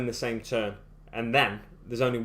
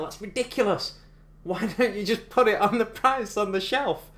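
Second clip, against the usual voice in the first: exasperated rant; 8 semitones higher, 3 dB louder than usual. Real, not acted. Ranting about American sales tax.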